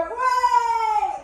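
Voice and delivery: high, stretched-out cheer; mock crowd cheer. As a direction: cheer like a crowd hearing a favourite.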